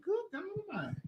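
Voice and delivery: very soft awed exclamation; stunned hush. Retold or imitated. Kid seeing mama talk to herself, hushed. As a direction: breathe it out stunned.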